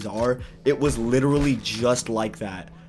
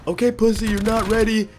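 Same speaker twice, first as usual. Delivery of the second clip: high, louder sneering squeak; mocking tiny wizard; 11 semitones higher, 5 dB louder than usual. Imaginary wizard taunts the narrator, high and sneering. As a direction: taunt him in a pitched-up little voice. Mini Gandalf mocks.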